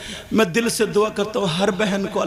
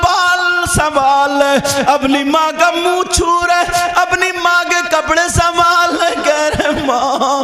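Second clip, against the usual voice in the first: loud, raised storytelling sermon; impassioned religious storytelling; fervent preaching; 8 semitones higher, 6 dB louder than usual. A narrator's reading. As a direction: preach the story at full force to the crowd.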